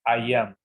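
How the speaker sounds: "I am" said together as "ai am"; English pronunciation. Shown as a demonstration. Teacher shows how both are pronounced together, "ai am". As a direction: run "I am" together, starting on an "ai" sound. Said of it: "¿Y cómo se pronuncia ambos juntos?".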